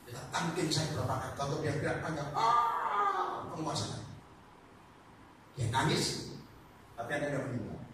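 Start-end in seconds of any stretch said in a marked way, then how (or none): 2.3-4.0 high strange demon voice; high eerie possessed cry; different voice. Retold or imitated. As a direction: let the spirit speak through her, up high. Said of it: "Suaranya beda".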